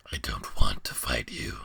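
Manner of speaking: very low, hushed monster voice; deep whispering beast. Acted. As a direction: breathe it out like an ancient beast. Beast reluctant.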